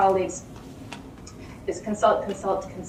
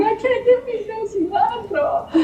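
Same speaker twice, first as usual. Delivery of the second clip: high, slow, hammed-up wail; mock crying; 12 semitones higher than usual. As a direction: whine it like a sobbing friend. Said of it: "crying and crying".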